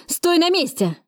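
high, frantic order; wild cry. A narrator's reading. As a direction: order them to freeze in a high voice. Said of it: "закричал Лепко с диким выражением лица". (высокий истошный окрик)

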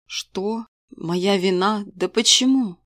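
usual speaking voice

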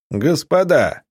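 a captain hails the besieged through a megaphone. A narrator's reading. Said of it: "усиленный мегафоном голос".

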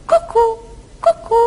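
high cuckoo call. Acted. Cuckoo calling out in the forest, high. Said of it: "Zakukała".

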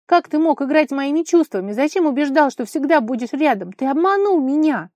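loud, high-pitched accusation; impassioned exclamation. A narrator's reading. Vara accusing Kolya of deceiving her, crying out. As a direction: cry out in hurt outrage. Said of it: "воскликнула она".

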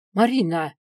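stern, scolding cry; angry shout. A narrator's reading. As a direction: call the name out crossly. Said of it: "сердито прикрикнул дракон".

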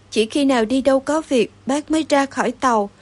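ordinary delivery

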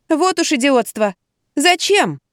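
high-pitched, baffled protest; flabbergasted. A narrator's reading. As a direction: dismiss the idiotic idea in bewilderment. Narrator shocked by absurd suggestion. (ошарашенно, с недоумением)

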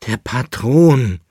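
slow, loud, drawn-out moan; groaning. A narrator's reading. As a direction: groan it out in despair. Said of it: "stöhnte der Leitnitzer". (gequält gestöhnt)

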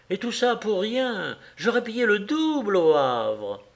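high, eager insistence; excited justification. A narrator's reading. Wife defending purchases to husband, excited. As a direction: insist on what a bargain it was.

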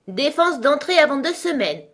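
high, loud creature forbidding entry; beaked creature's refusal. Acted. Beaked creature shuts Alice out, high and loud. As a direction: call out the ban high and forcefully.

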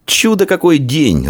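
high, drawn-out delight; elated boy's cry; joyful exclamation. A narrator's reading. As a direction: exclaim happily, pitched high and stretched. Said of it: "радостно воскликнул Идарий".

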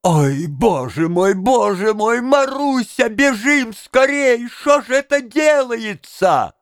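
wailing alarm climbing high; high, drawn-out panic; panicked bystander. A narrator's reading. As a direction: build from a lament to a high, urgent cry. Panicked bystander urges Marusya to flee.

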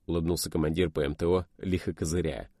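usual speaking voice

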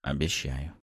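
soft, low and intimate; low seductive murmur. A narrator's reading. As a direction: breathe the promise low. Seductive promise.

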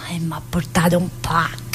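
hushed secret whisper. Acted. Inmate whispering the secret of her mother's package. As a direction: lean in and whisper it.

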